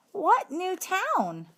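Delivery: put-on high, slow, puzzled girl's question; a young student asking, baffled; puzzled schoolgirl. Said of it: "Heidi Hopper asked".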